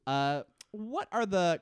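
ordinary delivery

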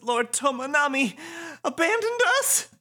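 very high, stricken question; dismayed devotee. Acted. Nagi realizing her lord has gone, dismayed. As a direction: wail it in disbelief.

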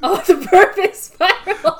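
high, loud excited echo; gleeful, high-pitched shout; mock-awed cheer. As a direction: cheer it like a thrilled sports fan. Joins in the absurd football bit.

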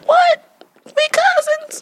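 high squealed disbelief; shocked. Retold or imitated. Sister imitating cousin's shocked reaction at family gathering. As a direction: shriek it like you just found out. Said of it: "and Jasmine like".

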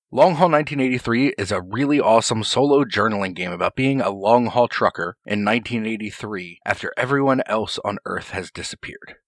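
ordinary talk, nothing marked

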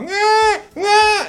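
put-on very high whining eh eh like a crying child; fox cry imitation. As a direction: whimper like a fox at dawn. (verso della volpe acutissimo)

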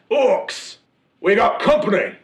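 high, loud hail to the crew; rally the crew with a shout; shouted call to crew. Acted. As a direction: orc captain hailing his crew from deck. Captain calls to crew.

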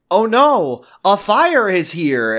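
loud, high, slow mock alarm; hammy bad acting. Teen in a shitty home movie spots fire, hammed-up alarm. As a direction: overact it like a cheap home movie.

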